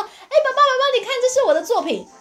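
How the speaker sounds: put-on high, eager child voice with a laugh; excited little girl. Little girl shows her dad her work, high and eager. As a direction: play the proud little girl.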